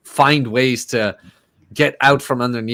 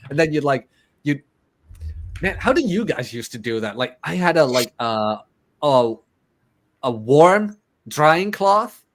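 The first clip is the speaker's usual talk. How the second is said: ordinary talk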